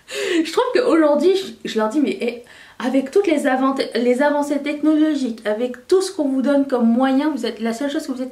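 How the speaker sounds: a mock-indignant outburst; exasperated rant. Real, not acted. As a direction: let the irritation burst out high. Ranting at English speakers.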